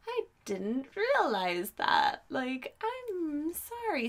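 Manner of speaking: slow, drawn out, softening and rising high; meek apology. Retold or imitated. Driver apologizing to the cop, sheepish and drawn out. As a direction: play innocent, stretching out the sorry.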